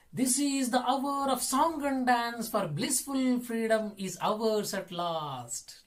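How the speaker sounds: high, sustained declaiming with drawn-out ending; poem recitation. Acted. Teacher reading verse to children, high sustained recitation. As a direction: declaim the verse, stretching the final line.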